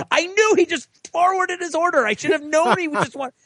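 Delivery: high, squeaky gloating imitation; mocking high teasing voice. [name] gloating to everyone, high mocking voice. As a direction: crow it in a put-on high voice.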